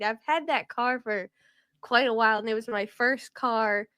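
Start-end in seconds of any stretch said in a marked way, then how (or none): none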